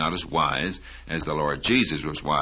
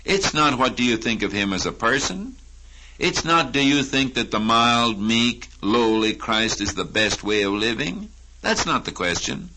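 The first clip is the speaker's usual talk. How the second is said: ordinary talk, nothing marked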